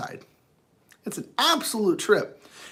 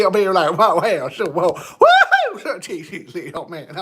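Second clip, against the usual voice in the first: laughing; 5 dB louder than usual. Pretend. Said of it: "Southern accent bag".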